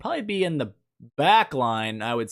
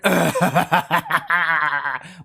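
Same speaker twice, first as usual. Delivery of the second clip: long, rapid laugh; burst of laughter. Real, not acted. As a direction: laugh it out in a run. Laughs after fumbling skip button.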